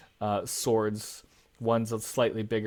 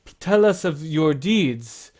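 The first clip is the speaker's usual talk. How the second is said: higher, louder, slower big farmer's plea; a big gentle farmer pleading, raised and slow; Pericles, humble farmer; 6 semitones higher, 7 dB louder, slower than usual. Acted. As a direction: humbly beg the guests for their tales. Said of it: "He says".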